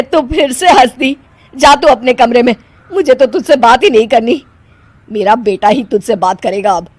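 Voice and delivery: loud stern scolding of the bride. Acted. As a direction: tell her off and send her away. Mother-in-law scolding Anshu.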